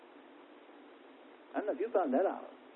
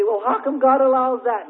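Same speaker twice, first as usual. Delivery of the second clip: mock complaint; 8 semitones higher, 8 dB louder than usual. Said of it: "sit around and say".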